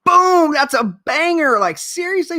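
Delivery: high, thrilled squeal of delight; excited. Retold or imitated. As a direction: gush like a kid opening a great pack. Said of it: "I'm like".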